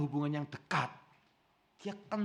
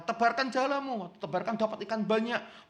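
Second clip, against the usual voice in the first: mock call; 7 semitones higher, 4 dB louder than usual. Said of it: "seseorang berteriak dari pinggir pantai".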